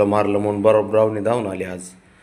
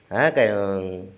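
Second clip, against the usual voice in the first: ordinary talk, nothing marked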